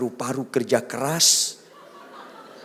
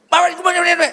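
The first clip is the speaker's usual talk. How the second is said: high, loud angry yell; a wife yelling at her husband; shouting wife; 11 dB louder, much faster than usual. Retold or imitated. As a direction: bark it like a furious wife.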